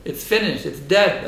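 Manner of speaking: ordinary talk, nothing marked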